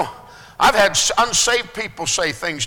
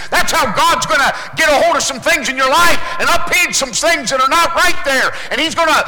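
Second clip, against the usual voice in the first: fervent preaching; 6 semitones higher, 3 dB louder than usual. Acted.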